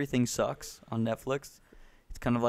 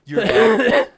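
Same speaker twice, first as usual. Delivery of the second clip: mock outburst; 11 semitones higher, 13 dB louder than usual. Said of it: "He's probably like".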